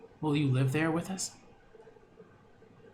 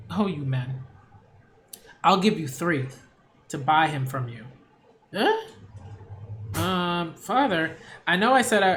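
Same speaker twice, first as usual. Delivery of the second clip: put-on young daughter pleading; 4 dB louder than usual. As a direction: plead with your father sweetly but urgently. Daughter voice again.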